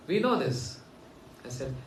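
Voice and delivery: usual speaking voice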